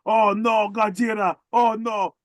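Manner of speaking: high, loud screaming crowd; panicked shout. Retold or imitated. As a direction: shriek in mock terror.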